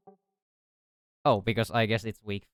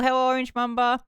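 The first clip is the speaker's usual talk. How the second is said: excited greeting; 5 dB louder than usual. Real, not acted.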